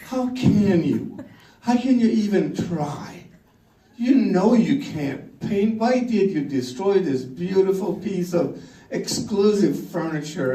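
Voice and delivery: high, slow, aghast wife losing it; wife scolding over the repainted table, outraged; wife's outrage. Retold or imitated. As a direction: tear into him over the ruined table. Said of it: "She tears her hair".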